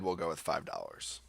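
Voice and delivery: usual speaking voice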